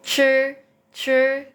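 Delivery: "sure" as a careful curled-tongue syllable; "sure" with teeth closed and lips slightly pushed out; demonstrated retroflex zh. Shown as a demonstration. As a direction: say "sure" as Mandarin zhi, teeth together. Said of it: "Kita mengatupkan gigi".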